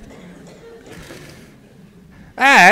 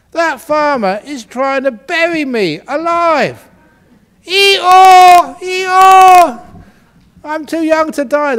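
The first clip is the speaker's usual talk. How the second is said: high, loud braying panic; donkey realising it is being buried alive, screaming; panicked donkey; 12 semitones higher, 7 dB louder, slower than usual. Acted. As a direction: bray in terror. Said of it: "the donkey realized".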